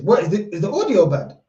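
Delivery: ordinary delivery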